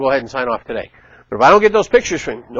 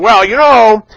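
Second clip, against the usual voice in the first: mock inspector; 6 semitones higher, 9 dB louder, slower than usual. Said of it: "the inspector's going".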